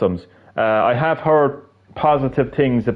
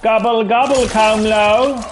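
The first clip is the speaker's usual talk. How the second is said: taunting; 9 semitones higher, slower than usual. Pretend. Taunting as Shang Tsung eats.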